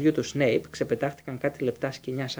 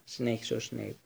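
usual speaking voice

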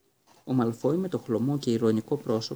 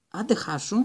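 high, sharp voiced shove-off; angry shoo; 7 semitones higher than usual. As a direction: chase the cat off with a higher snapped line. Ron shoos the cat angrily. (ενοχλημένο, ψηλό «άντε χάσου»)

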